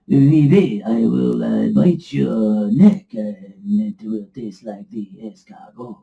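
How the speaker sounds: hammed-up French accent, slow and low; mock French accent. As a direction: play a cartoon French crocodile, drawn out. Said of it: "That was very French of me".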